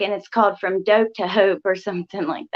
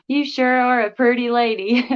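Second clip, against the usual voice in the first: put-on country accent; 3 semitones higher than usual. Streamer repeating a viewer's compliment after laughing, playful country accent. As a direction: ham up the country accent, amused. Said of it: "country accent".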